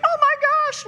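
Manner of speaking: a hammed-up mother panicking; mock panicked shriek of the helicopter mom; freaking out. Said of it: "freaking out".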